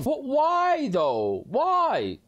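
high, drawn-out playful lament; mock anguished wail. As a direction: wail the why in mock grief. Laments killing the character.

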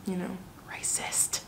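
hushed whisper, slowing down; whispered aside. Real, not acted. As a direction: whisper it like a secret. Aside about Alabama.

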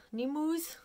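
ordinary talk, nothing marked

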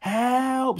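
high, loud cry for help; panicked boy's scream. A narrator's reading. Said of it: "screamed Ned".

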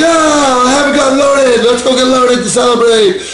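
high-pitched, gleeful mock cheer; giddy celebration. Addict's ego talking himself into celebrating, giddy. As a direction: crow it like great news, high-pitched. Mocks own urge to celebrate getting loaded.

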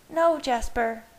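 high-pitched alarmed protest; gasped. A narrator's reading. Bella tries to stop Jasper, gasping in alarm. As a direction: blurt it out like a gasp. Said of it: "gasped Bella".

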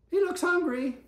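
put-on high, loud little girl voice; girl's voice. A narrator's reading. Girl worried about the stray, high and loud. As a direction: pitch it up high like a young girl. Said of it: "said the girl".

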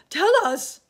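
high, loud demand; eager cry. A narrator's reading. Earthworm crying to James. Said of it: "cried the earthworm".

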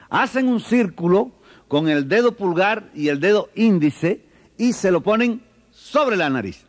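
high, slow instructional singsong; teacher to children. Acted. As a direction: explain it step by step to small children. Teacher demonstrating answer to children.

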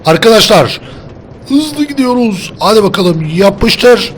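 high, loud whooping calls; excited shouting. Real, not acted. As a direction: holler like a cart is racing away, drawing out words. Excited calling during fast cart ride.

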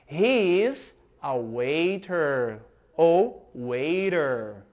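slow, spelled-out teacher model; slow model reading. Shown as a demonstration. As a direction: read it slowly for students to copy. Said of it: "Repitam comigo". (leitura lenta de professor)

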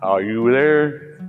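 normal talking voice

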